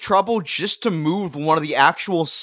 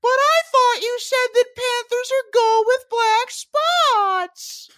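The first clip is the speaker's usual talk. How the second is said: put-on dumb kid voice; slower than usual. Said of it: "one of the kids is like".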